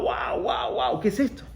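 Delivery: breathy, stunned gasp of alarm; a man shocked by his own catastrophic thoughts; startled exclamation. Retold or imitated. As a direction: react as if hit by a wave of fear. Sudden rush of panicked thoughts.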